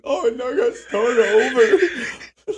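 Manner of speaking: high, slow, loud whiny parody; mock the teary vlogger restarting; fake crying influencer.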